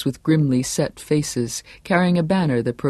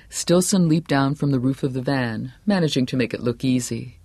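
ordinary delivery, nothing marked

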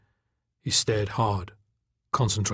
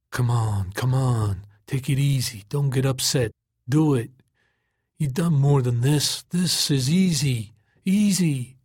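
coaxing himself; 3 semitones higher than usual. A narrator's reading. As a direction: urge yourself on through effort. Coaxing himself while concentrating.

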